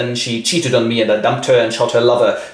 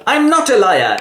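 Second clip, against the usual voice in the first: put-on indignant girl; 10 semitones higher than usual. Jane denies accusation.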